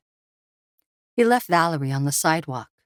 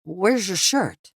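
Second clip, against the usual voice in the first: dazed stammer; 3 semitones higher, slower than usual. A narrator's reading. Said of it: "Sydney managed to stammer".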